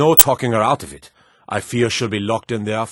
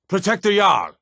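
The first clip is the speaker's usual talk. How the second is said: alarmed shout; 10 semitones higher than usual. Acted. Guard raising the alarm to protect the Jarl, shouting. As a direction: yell it out to rally the guards.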